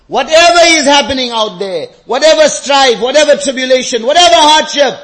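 loud, high, building list; impassioned preaching. Real, not acted. Preacher listing the world's hardships, impassioned. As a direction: hit each item with full pulpit force.

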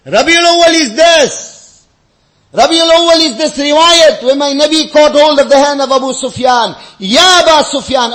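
loud, high pulpit proclamation; impassioned preaching. Real, not acted. Preacher recounting the Prophet addressing Abu Sufyan, impassioned. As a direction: proclaim it to the whole congregation.

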